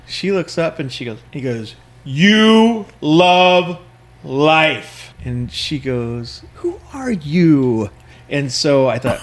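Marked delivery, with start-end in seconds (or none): 2.0-5.1 high, drawn-out mock holler; retold yell. Guy calling to a woman at the front, mock yell. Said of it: "the guy yells". 6.5-7.9 slow, stretched-out imitated retort; puzzled retort. Woman answers the stranger's shout.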